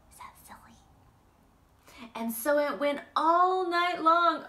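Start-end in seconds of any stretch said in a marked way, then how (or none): 0.1-0.8 hushed whisper. Real, not acted. Reader whispering a question to the children. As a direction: ask them softly.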